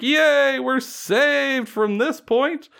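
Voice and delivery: a hammed-up relieved crowd; mock cheer. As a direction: cheer like the townsfolk think they're saved. People cheering, retold.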